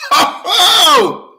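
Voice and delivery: high excited whoops; whooping ho ho. Real, not acted. Hyped host whooping high to open.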